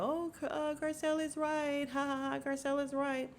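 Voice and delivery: high-pitched, sing-song taunt; silly high mimicry of gloating women; mocking mimicry. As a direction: put on a high mocking voice with a fake ha-ha. Mimics Kathy and Kyle mocking Garcelle.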